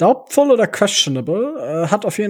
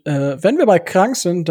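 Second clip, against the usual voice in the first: usual speaking voice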